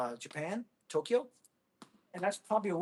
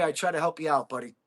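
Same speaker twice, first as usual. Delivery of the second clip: ordinary delivery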